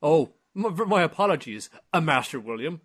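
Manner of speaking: high, loud startled apology; a servant caught out, apologizing fast; flustered butler. Retold or imitated. Butler apologizing to young master.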